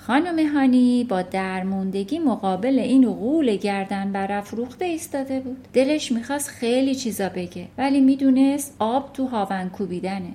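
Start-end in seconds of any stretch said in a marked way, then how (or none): none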